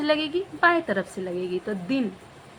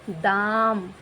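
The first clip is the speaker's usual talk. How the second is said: दाम said slowly and deliberately; drawn-out demo; much slower than usual. Shown as a demonstration. Teacher to children, slow clear दाम. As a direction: stretch दाम for the pupils. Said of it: "teacher stretching the word".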